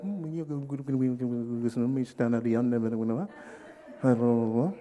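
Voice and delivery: low, quiet mock-Hungarian mumbling; subdued nonsense murmur, pitched low; unmoved Hungarian man. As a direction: imitate a stolid foreigner murmuring gibberish. Imitates unmoved Hungarian man's speech.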